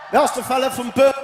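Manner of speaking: put-on high, loud Birmingham accent; lightly put-on Birmingham accent; Brummie accent. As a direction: belt it in a Brummie voice. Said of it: "anybody from Birmingham that talk like that".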